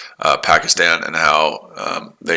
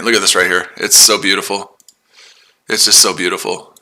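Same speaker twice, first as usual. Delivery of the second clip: ordinary talk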